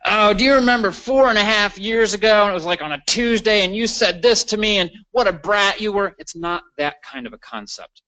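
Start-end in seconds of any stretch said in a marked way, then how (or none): 0.1-6.1 high, loud put-on dredging up of an old grievance; mimicking someone harping on the past; mock nagging reminiscence. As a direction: rattle off a petty old complaint in a higher voice. Acted quote of nagging people.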